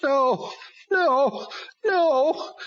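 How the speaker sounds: high, loud crying demon voice; whimpering demon. A narrator's reading. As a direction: cry out in pain like a beaten demon. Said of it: "it whimpered".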